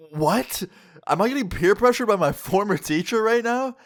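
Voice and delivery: high-pitched, amused disbelief; incredulous. Retold or imitated. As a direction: balk at it, voice shooting up. Disbelief at teacher's peer pressure.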